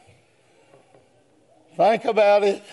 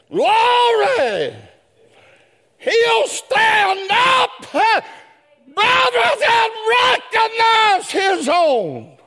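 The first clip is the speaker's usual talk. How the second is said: high, sustained pulpit shout; full-force preaching; 14 semitones higher, slower than usual. Real, not acted. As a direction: cry it out with drawn-out words. Preacher breaks into shouted praise.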